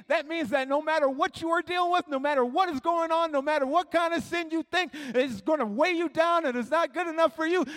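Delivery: high, loud, driving sermon delivery; impassioned preacher at full voice; full-force preaching. Real, not acted. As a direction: preach it hard to the whole room.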